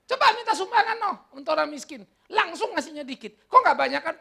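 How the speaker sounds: high, loud, teasing demand; mock challenge. Preacher testing rich believers for donations to the poor, mock challenge.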